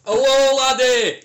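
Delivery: a loud, high, hammed-up Scottish greeting; a terrible Scottish accent, called out; bad Scottish accent. Asked by his mates to do Scottish, a bad loud Scottish accent. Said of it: "can you do a Scottish accent for us".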